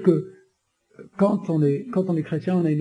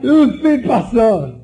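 high, loud, very slow commanding voice; God thundering a firm no; God's command; 6 semitones higher, 5 dB louder, much slower than usual. Retold or imitated. God forbids him to use violence, loud and slow. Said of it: "pourquoi Dieu m'a dit".

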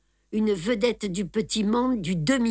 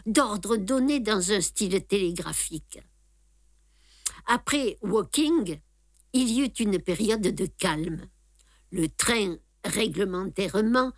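ordinary delivery

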